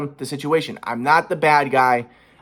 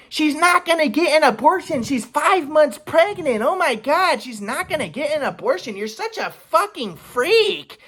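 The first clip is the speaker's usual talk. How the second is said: much higher, slow mock of mom scolding; 12 semitones higher, slower than usual. Son imitates mom scolding him, high mocking voice. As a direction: sneer it as his mom, pitched way up. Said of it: "And my mom was like".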